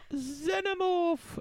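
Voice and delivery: "Xenomorph!" cast very high and slow as a spell; Harry Potter spell. Retold or imitated. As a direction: shout "Xenomorph" like casting a British spell. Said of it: "That's how Harry Potter would say it".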